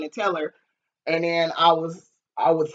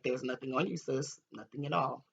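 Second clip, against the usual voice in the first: soothing; 4 semitones lower, 14 dB quieter than usual. Retold or imitated. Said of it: "I'm like".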